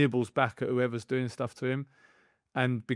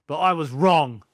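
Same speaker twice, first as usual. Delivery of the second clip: emphatic admission; 4 semitones higher, 9 dB louder than usual. Real, not acted. Admits he misjudged Alfie.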